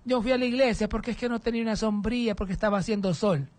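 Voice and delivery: high-pitched, wheedling excuse; excusa quejumbrosa. Acted. Acts out a member's excuse. (excusa lastimera, en voz aguda)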